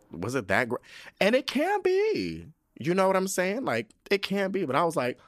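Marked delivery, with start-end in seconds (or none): 1.2-4.6 sudden high-pitched insistence; high emphatic. Real, not acted. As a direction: stretch it out, way up high. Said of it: "emphatic aside".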